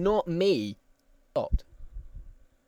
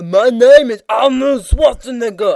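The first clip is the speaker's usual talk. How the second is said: louder and higher, hammed-up Austrian accent; a terrible Austrian accent, much louder; loud bad Arnold impression; 6 semitones higher, 11 dB louder than usual. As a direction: shout a cheesy Austrian accent down the phone. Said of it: "absolute shit impersonation".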